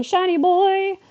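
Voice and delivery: imitated kid shriek; yelled mimic. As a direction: scream it like a wild kid. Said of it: "running around screaming, yelling".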